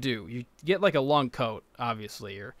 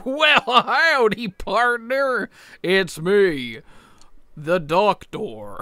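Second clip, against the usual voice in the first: put-on cowboy accent; 4 semitones higher, much slower than usual. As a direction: drawl it like a goofy cowboy Doctor. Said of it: "It's not even an accent".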